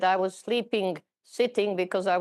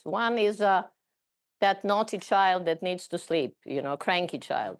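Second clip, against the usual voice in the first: usual speaking voice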